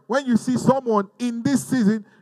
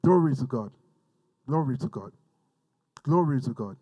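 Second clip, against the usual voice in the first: quiet worship; 7 semitones lower, 5 dB quieter than usual. Real, not acted. Soft repeated worship.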